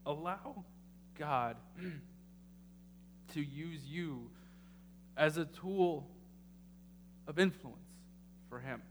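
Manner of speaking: rising preacher's build to the congregation; preaching. Acted. Pastor urging his congregation, building preaching. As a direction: drive the point home like a sermon.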